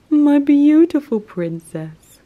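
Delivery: high, loud cooing pet voice; slow high sing-song endearment. Real, not acted. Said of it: "repeated after whisper".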